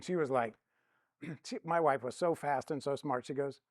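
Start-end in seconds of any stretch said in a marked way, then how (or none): none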